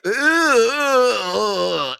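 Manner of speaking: loud retching ugh sounds; retching groans. Retold or imitated. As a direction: gag and groan.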